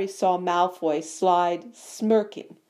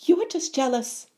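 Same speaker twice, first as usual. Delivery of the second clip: put-on high-pitched young fan butting in; piping up; 8 semitones higher than usual. A narrator's reading. Young fan challenges bully for his hero, piping up. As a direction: pipe up in his defence. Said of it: "piped up Colin".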